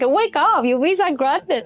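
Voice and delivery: acting out breaking good news to a sleeper; urgent, happy wake-up, retold; excited wake-up call. Waking him with visa news.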